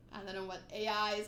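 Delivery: ordinary delivery, nothing marked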